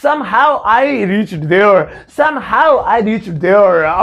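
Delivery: very slow, somehow stressed; slow demo sentence. Shown as a demonstration. Teacher modelling the English sentence to students, very slowly. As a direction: say it word by word for learners. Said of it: "जैसे तैसे मैं वहां पहुंचा".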